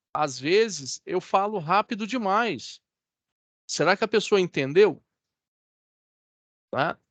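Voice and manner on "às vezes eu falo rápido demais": slow, softened, with spaced-out words; slow and measured. Shown as a demonstration. Trainer repeats the information for trainees, slowly and deliberately. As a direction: repeat it unhurriedly so it can be understood. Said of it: "Falo pausadamente, repito a informação".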